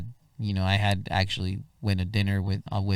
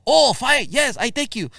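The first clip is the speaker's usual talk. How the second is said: relieved driver; 7 dB louder than usual. Retold or imitated. Cab driver finally understands.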